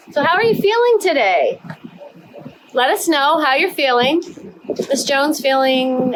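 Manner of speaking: kindergarten teacher voice. Acted. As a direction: ask little kids brightly. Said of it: "teacher to young children".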